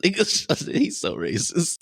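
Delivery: slow, drawn-out, high mock gasp; mock shocked quote. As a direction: stretch it out in fake shock. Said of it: "and I was like".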